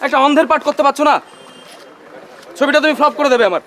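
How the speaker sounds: frustrated director, voice pushed up high; exasperated scolding. Acted. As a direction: berate a hopeless actor on set. Director scolds actor on set.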